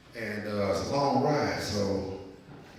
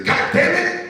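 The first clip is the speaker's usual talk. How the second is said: a loud, high hammed-up cry of frustration; mock exclamation; 8 dB louder, slower than usual. Host imitating the councilman cursing into the phone. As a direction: blurt it out like a startled man. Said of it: "And all he said is".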